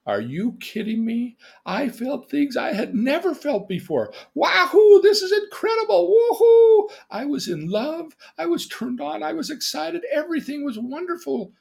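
giddy first-love rapture, high-pitched; hammed-up joy with loud high whoops; ecstatic. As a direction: squeal it like a lovestruck boy. Reenacts joy after first kiss.

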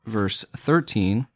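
usual speaking voice

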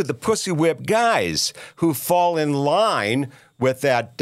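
normal talking voice

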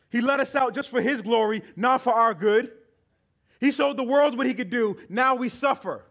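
grumbling crowd complaining loudly; angry griping. Retold or imitated. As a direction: rant against God and Moses. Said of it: "They griped, they complained, they yelled".

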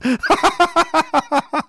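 high, loud laugh bursting out; loud burst of laughter. Real, not acted. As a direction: crack up at the comment. Laughing at a chat comment.

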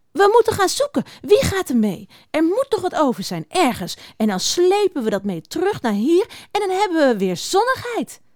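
high-pitched, eager mouse; rally the others for a search; opgewonden veldmuis. Acted. Opgewonden muis roept op tot zoeken. (hoog, enthousiast muizenstemmetje)

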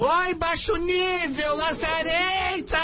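high, slow, drawn-out insults building to shouts; mock insulting diva. Prank caller insults the woman on the phone, high slow mock shouts. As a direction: hurl each insult like a catty diva.

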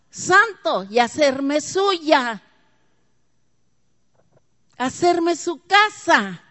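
preaching at full force. Real, not acted. Preacher rousing the congregation about the holy one, loud and high.

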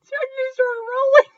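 very high, squeezed laughing voice; wheezy, high-pitched real laughter; helpless laughter. Real, not acted. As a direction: squeak the words out while dying laughing.

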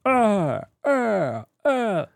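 mock falling "aah" noises; imitated dubbing grunts. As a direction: imitate cheesy cartoon voice-acting sounds. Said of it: "כל הצלילים של ה". (חיקוי קולות מאומצים של דיבוב)

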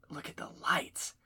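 breathless awed whisper. Acted. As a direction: hush it like you're amazed. Awed at photo.

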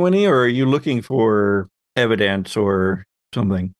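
ordinary delivery

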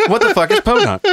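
very high mock-incredulous squeak; baffled girlfriend. South African girlfriend asking about a strange word, mimicked as baffled. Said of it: "it blew me away that she didn't know".

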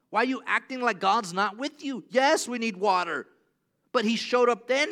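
loud, high frustrated questioning; exasperated Moses. Retold or imitated. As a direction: Moses pleading with a stubborn crowd. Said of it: "'Cause Moses is like".

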